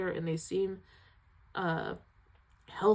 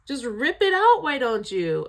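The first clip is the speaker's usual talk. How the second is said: higher, louder cry of mock despair; exasperated outburst; 5 semitones higher, 9 dB louder than usual. Real, not acted. Host protesting how painful the film is, loud outburst. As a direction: throw the line up in anguish. Heart already wrenching.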